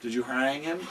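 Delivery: ordinary talk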